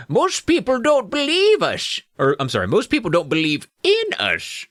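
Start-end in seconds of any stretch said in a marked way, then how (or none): 0.1-2.0 high, slow squeaky gnome; old gnome. Acted. Old gnome explains to the girls, squeaky. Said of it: "the old gnome said". 2.7-4.7 put-on old gnome. Old gnome explains to the girls, gnome voice. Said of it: "the old gnome said".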